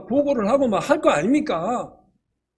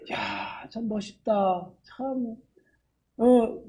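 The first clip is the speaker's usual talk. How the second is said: quiet admiration; 6 dB quieter, much slower than usual. Retold or imitated. Admiring falling leaves.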